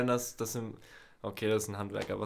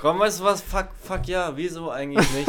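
loud, high hyped-up cheer; excited; 5 semitones higher, 7 dB louder than usual. Real, not acted. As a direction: pump it up loudly. Hyped mock enthusiasm.

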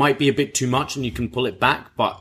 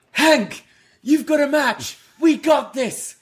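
high, strained imitation of a guard calling out; urgent mock encouragement; 14 semitones higher than usual. Security guards urging coworker Hank to his match, mock urgency. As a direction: send a pal off, pitched way up. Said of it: "as security is, like, holding each other back".

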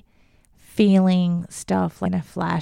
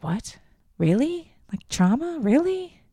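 climbing higher with each disbelieving really; shocked disbelief; 7 semitones higher than usual. Retold or imitated. Hearing from her therapist that her childhood was traumatic, rising shocked disbelief. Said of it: "And I was shocked by it".

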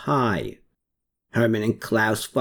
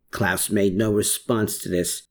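ordinary delivery, nothing marked

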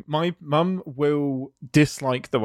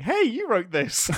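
quoted message; 8 semitones higher than usual. Retold or imitated. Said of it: "direct messages saying".

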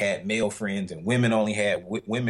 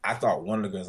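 ordinary talk

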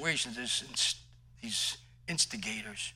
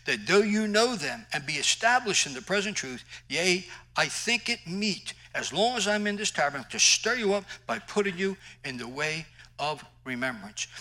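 raised scripture read, full force; preaching; 6 semitones higher, 5 dB louder than usual. Acted. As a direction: proclaim the verse to the congregation.

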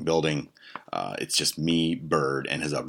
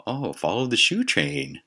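higher, drawn-out mock woman's voice; imitated hooker; 7 semitones higher, 3 dB louder, slower than usual. Imitated hooker reading the sign at the door, puzzling aloud. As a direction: read it slowly in a lilting, higher voice. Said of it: "don't I hear the fucking hooker say".

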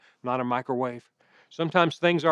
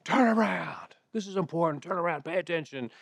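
retold yell; 3 semitones higher than usual. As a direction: imitate yelling at a kid across a ballfield. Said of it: "I yelled at him".

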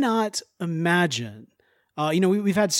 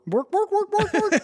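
much higher Swedish chef babble; mock Swedish chef. Joining the joke about slipping into Swedish, high mock Swedish chef babble. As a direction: play along with a silly Swedish chef bit. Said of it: "I think I went Swedish".

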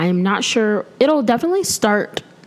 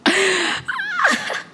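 high-pitched laugh. Real, not acted. Burp after warning.

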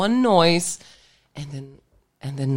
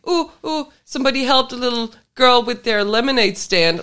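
mock excited; 6 semitones higher, 3 dB louder than usual. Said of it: "And I was like".

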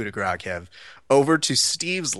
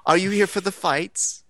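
higher, mock Australian-British lady; Australian-British accent; 7 semitones higher than usual. Lady asking a stranger about fights, mock Australian-British accent. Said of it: "like, Australian, British, whatever the fuck her accent was".

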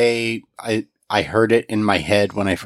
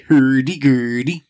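Swedish chef voice; 5 semitones higher, 4 dB louder than usual. Retold or imitated. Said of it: "like the Swedish chef from the Muppets".

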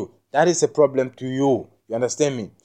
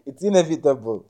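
talking through a mouthful of neem; words round a chew; chewing while talking; 4 semitones higher, faster than usual. Real, not acted. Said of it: "I'm chewing on some".

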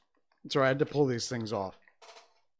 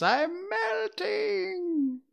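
very high, drawn-out screech; hammed-up dying wail, stretched long; mock melting witch; 3 dB louder, much slower than usual. Mimics the melting witch for fun.

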